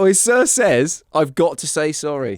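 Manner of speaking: slow, loud, laughing through it; laughing bully again. Retold or imitated. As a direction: drag the fake apology out while laughing. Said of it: "he was literally cracking up".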